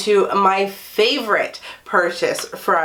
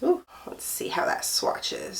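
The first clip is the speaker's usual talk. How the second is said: whispering; 9 semitones higher, 10 dB quieter than usual. Real, not acted. Opening new makeup, hushed.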